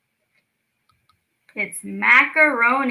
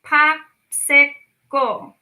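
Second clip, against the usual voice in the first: Pop-si-cle stretched very slowly and a bit louder; slow syllables; 3 semitones higher, 4 dB louder, much slower than usual. Shown as a demonstration. Teacher sounding out a word for kids. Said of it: "It's a popsicle".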